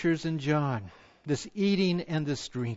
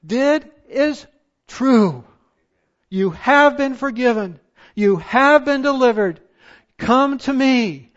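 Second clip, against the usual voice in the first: preaching at full force; 7 semitones higher, 9 dB louder than usual. Acted. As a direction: plead as God with full voice. Preaches God's plea loudly.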